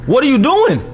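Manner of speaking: loud high-pitched disbelief; high incredulous yell. Real, not acted. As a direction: yell it at the screen. Yelling at the video.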